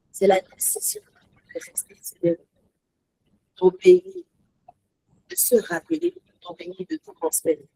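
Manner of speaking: breathy whispered point. Real, not acted. Preacher whispering the core truth to congregation. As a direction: whisper it to draw them in.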